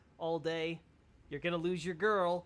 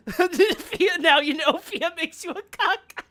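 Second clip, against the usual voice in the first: loud laughing; 13 semitones higher, 14 dB louder, much slower than usual. Real, not acted. Laughing loud about Fia.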